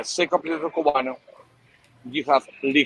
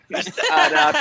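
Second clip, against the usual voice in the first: Arapiraca said high and laughing, a-ra-pi-ra-ca with tapped r; laughing correction; 13 semitones higher, 4 dB louder than usual. Shown as a demonstration. Laughing as he corrects the guys, once more. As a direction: correct them again through a laugh. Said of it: "you guys cannot say one word".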